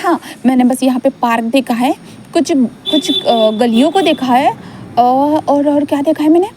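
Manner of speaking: put-on a small boy trying to remember; childlike Wing. Narrator voices child Wing.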